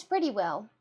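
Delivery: ordinary talk, nothing marked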